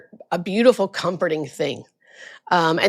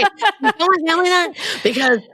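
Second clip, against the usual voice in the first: mock-fearful plea, pitched higher; afraid patient; 10 semitones higher than usual. Patient begging nurse to tell family, in a mock-fearful higher voice. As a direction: imitate a frightened old man asking for help. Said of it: "He's afraid to say".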